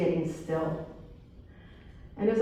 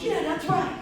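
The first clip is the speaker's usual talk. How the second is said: high-pitched imitation of an eager bystander; egging her on; 10 semitones higher than usual. Guy next to him backing up the coach, imitated eager agreement. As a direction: chime in like an approving onlooker, pitched up.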